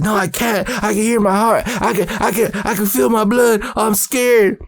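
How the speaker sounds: high, mounting mock panic; freaking out. Teen tells brother he feels his blood, panicking. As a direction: plead in a frightened high voice. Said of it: "I'm, like, freaking out".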